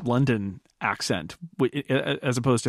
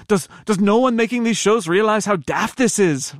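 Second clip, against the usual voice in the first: higher, louder bad put-on Irish accent; failed accent attempt; 8 semitones higher, 5 dB louder than usual. Reading a listener's letter back, hopelessly bad Irish accent. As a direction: badly fake an Irish accent. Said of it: "that's not an accent. That's not a thing".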